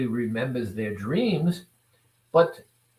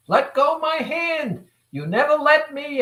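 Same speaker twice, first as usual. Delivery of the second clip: high-pitched child's protest, acted out; screaming child; 12 semitones higher, 4 dB louder than usual. Child self to the protective adult holding its hand, screaming protest. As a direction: yell like a child throwing a tantrum. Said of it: "the child starts screaming".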